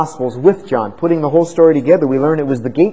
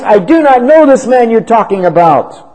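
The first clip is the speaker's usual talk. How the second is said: angry protest; 8 semitones higher, 5 dB louder than usual. Acted. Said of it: "he begins to curse and to swear".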